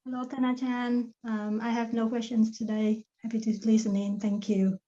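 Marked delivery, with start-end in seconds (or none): none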